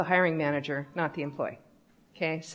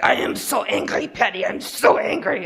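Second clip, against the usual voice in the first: hammed-up angry Russian accent; mock-furious Russian-accented complaint; 3 semitones higher, 3 dB louder than usual. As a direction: growl it like a big angry Russian. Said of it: "He's a big Russian guy".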